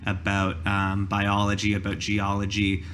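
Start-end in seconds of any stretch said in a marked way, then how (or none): none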